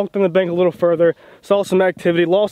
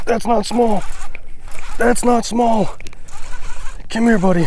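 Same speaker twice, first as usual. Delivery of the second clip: excited; 5 semitones higher than usual. Real, not acted. Big fish strike, shouting excitedly.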